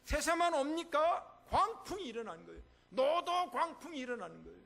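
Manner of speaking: loud, high sermon climax; fiery preaching. Acted. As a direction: pastor warning of storms ahead, fervent preaching. Preaching at full force.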